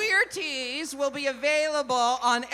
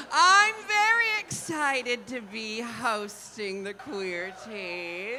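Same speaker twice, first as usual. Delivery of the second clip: ordinary delivery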